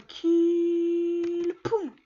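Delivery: high, loud drawn-out cry then sound effects; excited shout. Real, not acted. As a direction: yell while stealing the kill. Fighting for the kill. (cri aigu et appuyé, puis bruitages)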